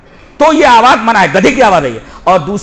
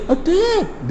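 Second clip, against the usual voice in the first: slow, softly spoken order; 7 semitones higher, 8 dB quieter, much slower than usual. Shown as a demonstration. Commander giving troops the order too softly, quiet. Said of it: "تو دھیرے".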